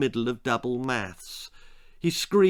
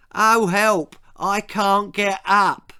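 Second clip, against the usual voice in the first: high, loud hammed-up helpless wail, slowing down; a bully faking a helpless turtle's cry; mock cry for help; 8 semitones higher, 6 dB louder, slower than usual. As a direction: play the stranded turtle for laughs. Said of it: "he cried, playing the stranded turtle".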